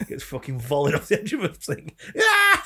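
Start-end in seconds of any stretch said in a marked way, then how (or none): 2.2-2.6 very high, loud mock scream; mock falling scream. Sacrificed man volleyed off the edge, high falling scream. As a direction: scream like someone thrown off a pyramid. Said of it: "Screams all the way down".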